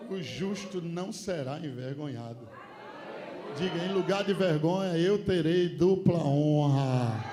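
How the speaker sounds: loud, slow proclamation; preaching proclamation. Acted. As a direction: declare it slowly to the church. (proclamação lenta e forte)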